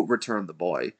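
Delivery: usual speaking voice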